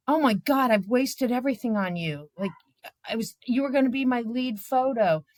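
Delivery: imitating a dismayed photographer; mock dismay. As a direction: whine about the lost shot.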